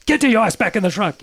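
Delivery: loud, high-pitched shouted command; angry yell. Retold or imitated. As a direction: imitate a guide yelling an urgent order. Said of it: "He just yells at me".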